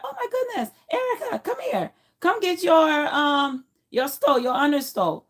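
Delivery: high-pitched gushing staff voice; high, delighted summons, acted out; imitated excited calling. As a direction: call her over in a high, excited mock voice. Excited staff calling her over.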